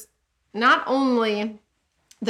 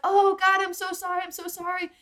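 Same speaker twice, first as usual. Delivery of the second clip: mock pleading; 7 semitones higher than usual. Israelites begging God, retold mockingly.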